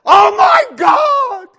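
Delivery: high, very loud, drawn-out shriek; mimicked amazed screaming. Homeowners see their new house, hammed-up amazed shout. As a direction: shriek each word long, imitating overwhelmed people. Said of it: "they all do the same thing".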